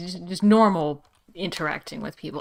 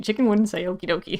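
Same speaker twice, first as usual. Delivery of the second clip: usual speaking voice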